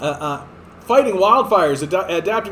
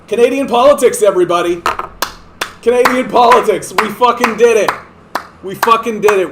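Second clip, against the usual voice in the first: loud, higher mock victory shout; sarcastic triumphant yell; 4 semitones higher, 6 dB louder than usual. Real, not acted. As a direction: yell a bitter fake celebration.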